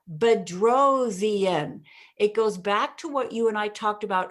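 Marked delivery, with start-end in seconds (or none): 0.1-1.8 "Bedrosian" very slow, every syllable drawn out; punched name. Shown as a demonstration. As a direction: paint "Bedrosian" in the sky. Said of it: "when I paint in the sky".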